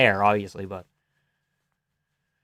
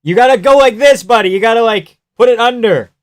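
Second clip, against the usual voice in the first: raised, high, loud instructions; urgent coaching; 8 semitones higher, 8 dB louder than usual. Real, not acted. Viewer telling fighter how to finish the armbar, urgent coaching. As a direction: call out urgent corrections to the fighter.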